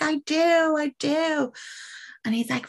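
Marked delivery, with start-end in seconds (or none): 0.0-1.5 higher, fervent I do; fervent confession. Acted. Priest answering the devil's question, fervent. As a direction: blurt it out desperately.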